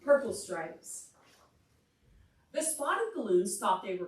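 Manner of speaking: lively read-aloud to a class; story reading for children. Acted. Teacher reading a picture book to the class, lively storytime voice.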